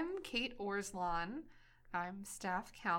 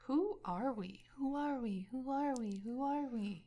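repeated with a fading echo; echoing voice, quieter each time; echo effect; 5 semitones higher, 3 dB quieter than usual. Pretend. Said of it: "It's an echo effect".

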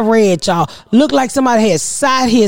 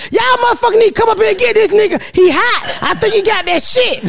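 higher-pitched urgent demand; retold frantic call; 8 semitones higher than usual. Mother retells calling emergency services, frantic. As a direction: act out a panicked, pushy emergency call.

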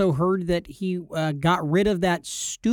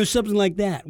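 usual speaking voice

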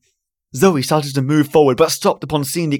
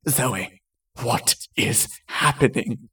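soft, slow, breathless question; 3 semitones lower, 7 dB quieter, slower than usual. A narrator's reading. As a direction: ask it barely getting air out. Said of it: "in a breathless voice".

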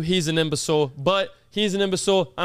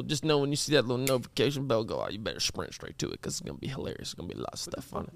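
drops to a secret whisper; fading to a whisper; 4 semitones lower, 7 dB quieter, faster than usual. Real, not acted. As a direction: trail off into a hush. Teasing the vlog, fading off.

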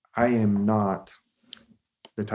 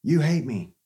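higher, louder mock-whiny retort; childish sulk; 6 semitones higher, 3 dB louder than usual. Us answering criticism like a hurt child, mock whine. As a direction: pout like a kid who got told no. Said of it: "and we go".

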